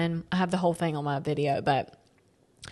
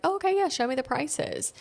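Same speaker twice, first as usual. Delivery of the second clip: high-pitched, airy acceptance; mock breezy agreement; 9 semitones higher than usual. Customer answering the pearl seller, breezily agreeable. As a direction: act out an easygoing yes, pitched up. Acts out her agreeable reply.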